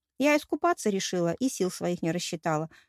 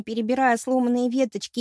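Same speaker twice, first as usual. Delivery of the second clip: usual speaking voice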